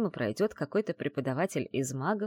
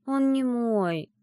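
slow, drawn-out, higher correction; weary reminder; 8 semitones higher, 5 dB louder, much slower than usual. A narrator's reading. Heroine correcting her friend once more, weary. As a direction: stretch it out, tired of repeating. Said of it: "уже устало напомнила я".

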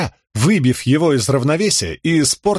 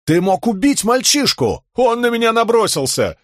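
raised, heated protest; angry; 10 semitones higher than usual. Acted. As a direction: argue back hotly. Argument after sparring.

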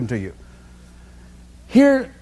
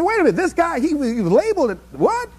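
mock amazed listener; 6 semitones higher than usual. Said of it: "people who look at me and say".

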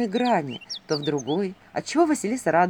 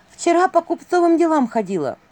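put-on high, louder young heroine voice; heroine reporting; 10 semitones higher, 7 dB louder than usual. A narrator's reading. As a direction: report to the prince, pitched up.

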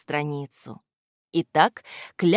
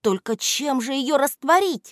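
put-on high anxious girlish voice; worried girl; 9 semitones higher than usual. A narrator's reading. Alya worries how to dissolve the salt: high anxious voice. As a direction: fret like a little girl. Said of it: "заволновалась Аля".

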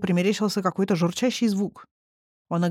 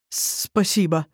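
forced out; much slower than usual. Acted. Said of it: "выдавил Йоргол".

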